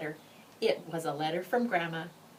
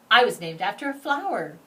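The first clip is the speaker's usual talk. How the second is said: ordinary delivery, nothing marked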